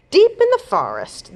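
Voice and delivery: high, loud spooky start; dramatic storytelling. A narrator's reading. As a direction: draw them into the forest. Storybook scene setting.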